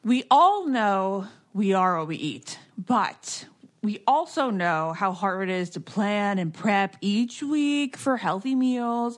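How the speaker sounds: loud upbeat sponsor read. Acted. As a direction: sell it like a podcast ad. Host reads sponsor ad.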